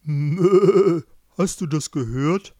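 loud wobbly bleat, then talking ram. A narrator's reading. Ram comments on events to the sheep, bleating. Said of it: "Die Schafe".

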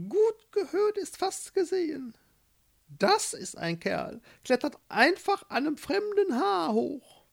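high-pitched little mole voice; admiring mole. A narrator's reading. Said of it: "Manni bewunderte den Prinzen fast". (bewundernder Maulwurf mit hoher Stimme)

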